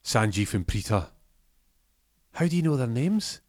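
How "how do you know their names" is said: much higher, alarmed ask; startled by what he knows; shocked question. Acted. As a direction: Arjaf asks how he knows his family. Arjaf shocked.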